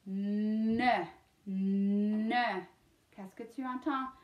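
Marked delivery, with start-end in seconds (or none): 0.1-2.6 Ne with a long held "nnn" before a short "uh"; third syllable isolated. Shown as a demonstration. Teacher sounds out third syllable, drawn-out Ne. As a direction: hum the N, then release into Ne. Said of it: "Troisième syllabe".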